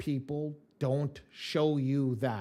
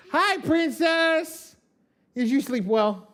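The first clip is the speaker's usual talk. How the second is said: high, loud and drawn-out call to his daughter; excited greeting; 12 semitones higher, 9 dB louder, much slower than usual. Real, not acted. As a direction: sing out a big happy hello. Dad greeting daughter coming downstairs.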